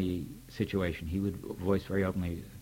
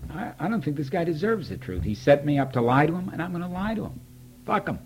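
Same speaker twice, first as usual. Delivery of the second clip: higher and higher, a worked-up rant; indignant outburst; 9 semitones higher, 3 dB louder than usual. Retold or imitated. Venting to his wife about a friend's book, worked up and much higher. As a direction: build from annoyed to fed up, going steadily higher.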